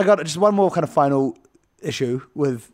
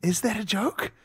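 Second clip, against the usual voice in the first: higher, softer puzzled query; doubtful inner question; 6 semitones higher, 7 dB quieter than usual. Retold or imitated. Viewer privately doubting the Beast's joke, soft puzzled question. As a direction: question it under your breath, rising. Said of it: "And I thought".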